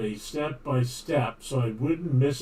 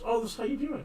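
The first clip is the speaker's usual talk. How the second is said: mock video tutor; 9 semitones higher, 5 dB quieter, much faster than usual. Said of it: "inundated with all these videos".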